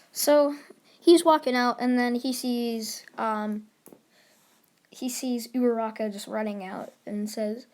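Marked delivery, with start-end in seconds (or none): none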